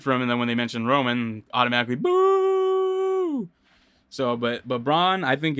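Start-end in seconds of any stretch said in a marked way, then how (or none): 2.0-3.5 long high mock crowd boo; imitating a jeering wrestling crowd. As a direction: boo like a whole arena. Crowd boos Roman's name.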